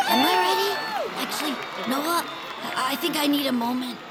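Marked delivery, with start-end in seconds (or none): 0.1-0.7 squeaky, much higher and louder nervous question; sudden panic. Real, not acted. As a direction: voice jumps up as nerves hit. Suddenly nervous before meeting hero.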